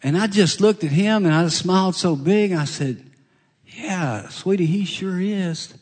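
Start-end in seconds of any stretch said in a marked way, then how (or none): 3.7-5.7 put-on soft and high, gently agreeing with the boy; tender reply to a child. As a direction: reassure the little one sweetly.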